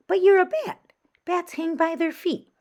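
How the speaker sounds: high, loud bat voice; a bold bat explaining the obvious; bat creature voice. Acted. Strange bat talks to Stella.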